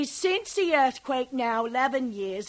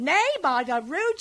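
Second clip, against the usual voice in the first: ordinary talk, nothing marked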